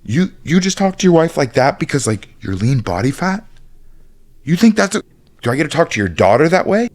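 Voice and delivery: high, loud outraged confronting; shouting at a bro who disrespects his wife; indignant shout at bro. Retold or imitated. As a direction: call him out, furious and loud. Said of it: "I would look at my bro and be like".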